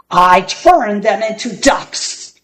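plain reading voice

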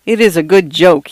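ordinary delivery

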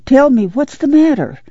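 high, worried motherly demand. A narrator's reading. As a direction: press anxiously for an answer. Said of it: "she demanded".

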